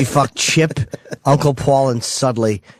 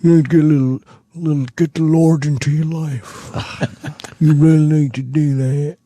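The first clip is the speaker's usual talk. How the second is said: southern Eugene voice; 3 semitones higher than usual. Acted. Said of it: "Eugene is like Edgar, but with a southern accent".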